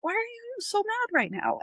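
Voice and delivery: put-on high, innocent childlike voice; husband asking wife why she's mad, high and childlike. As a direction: ask it like a bewildered child. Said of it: "childlike face and be like".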